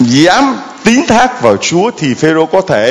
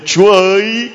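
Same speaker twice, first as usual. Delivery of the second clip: cry out; 7 semitones higher than usual. Acted. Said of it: "phải la lên".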